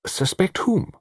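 high-pitched, earnest servant's plea; high, deferential protest; anxious pleading servant. Acted. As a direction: plead high for someone's innocence.

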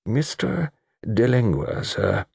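lightly voiced deferential butler; old butler. A narrator's reading. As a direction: announce a visitor as a butler. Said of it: "It was the old butler who spoke".

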